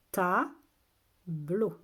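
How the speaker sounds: two well-separated syllables. Shown as a demonstration. Teacher repeats the word for listening children, syllable by syllable. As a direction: sound it out with a gap between beats.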